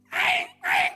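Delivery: very high mock yelp; dog yelp imitation. (tiruan lolongan anjing tinggi)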